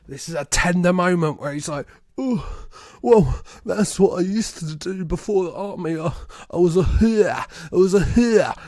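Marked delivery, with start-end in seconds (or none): 2.2-8.6 imitated, affected New Orleans accent; weird New Orleans accent. Gigolo confessing in a tender moment, affected New Orleans accent. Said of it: "this is the weird New Orleans accent".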